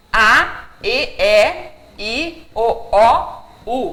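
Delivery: each vowel said cleanly, open and closed E and O kept apart; seven Portuguese vowels. Shown as a demonstration. Teacher listing the seven Portuguese vowel sounds. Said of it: "sete sons de vogais".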